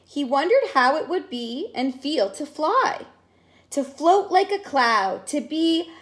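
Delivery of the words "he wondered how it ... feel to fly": usual speaking voice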